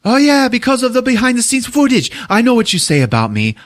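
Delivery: high-pitched mock outburst; imitated screaming. Director accuses crew of badmouthing him, screaming. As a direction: scream it accusingly, then ease down a little. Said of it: "he's screaming out at them".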